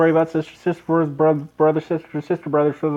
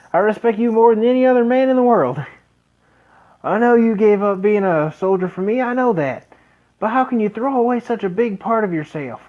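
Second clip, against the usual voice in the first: put-on higher, louder young man's voice; eager young son; 6 semitones higher, 4 dB louder than usual. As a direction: plead with his dad, fired up. Son pleads with father.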